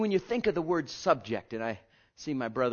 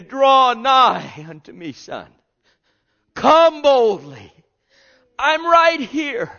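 tearful plea; 11 semitones higher, 16 dB louder, much slower than usual. Acted. God's voice quoted with emotion.

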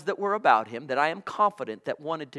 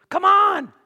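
high, loud preaching shout; fervent shout; 14 semitones higher, 10 dB louder than usual. Real, not acted. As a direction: belt it out to the congregation. Preacher exclaims mid-sermon.

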